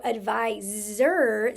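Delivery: ADVISOR slowed, with a long buzzed Z in the last syllable; stressed Z sound. Shown as a demonstration. Teacher pointing out a hidden Z sound, drawn out. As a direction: stretch the Z in ADVISOR. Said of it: "sounds like a Z".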